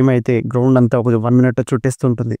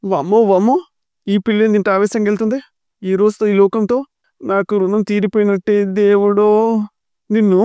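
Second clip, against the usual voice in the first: high, drawn-out mock despair; dramatic wail; 7 semitones higher than usual. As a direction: lament it like the world is ending. Vishwa wails after the girl leaves.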